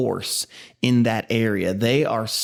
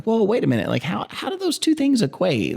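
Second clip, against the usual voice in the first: high, incredulous "whoa, wait a minute"; mock disbelief at the overreaction; raised pitch of bewildered puzzlement; baffled parent; 9 semitones higher than usual. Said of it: "you're like".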